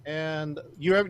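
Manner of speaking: ordinary talk, nothing marked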